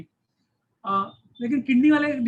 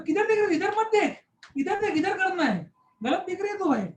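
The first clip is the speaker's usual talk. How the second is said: exasperated; 8 semitones higher than usual. Retold or imitated. Telling sonographer where the pain is.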